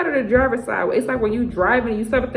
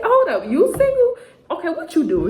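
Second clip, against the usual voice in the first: mock surprise; 8 semitones higher than usual. People surprised you're single.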